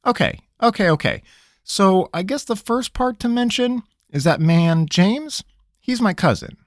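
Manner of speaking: a cornered trickster pleading; nervous country rogue. Acted. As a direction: squirm and stall, talking fast. Pickett voice.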